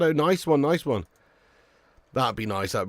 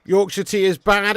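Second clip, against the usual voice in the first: ordinary talk, nothing marked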